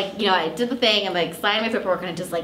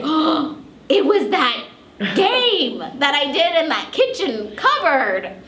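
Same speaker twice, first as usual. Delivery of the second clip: sudden realization; 9 semitones higher, 6 dB louder, slower than usual. Retold or imitated. Sudden realization about the check.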